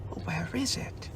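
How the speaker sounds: whispered, searching. Acted. Christopher Robin whispers while hunting for the present. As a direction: breathe it, no voice.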